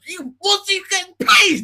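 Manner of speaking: high, slow, loud disbelieving shout; outraged shout. Real, not acted. Outraged rant about his pay.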